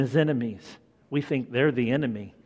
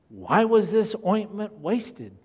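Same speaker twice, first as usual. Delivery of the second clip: indignant; 8 semitones higher than usual. Acted. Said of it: "said to themselves indignantly".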